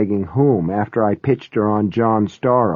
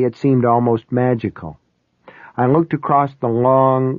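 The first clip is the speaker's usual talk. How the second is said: usual speaking voice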